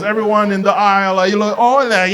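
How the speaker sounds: high sing-song teacher voice; kindergarten teacher mode. Acted. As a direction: quiz the class like little children. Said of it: "kindergarten mode".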